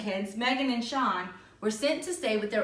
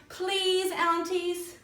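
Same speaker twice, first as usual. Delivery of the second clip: much higher pleading "Please"; begging; 8 semitones higher, much slower than usual. Acted. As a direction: coax them sweetly as kids who want a favor. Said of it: "asked, begged Meg and Sean".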